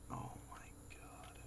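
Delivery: breathless scared whisper. Real, not acted. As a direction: whisper it in dread. Scared horror game reaction.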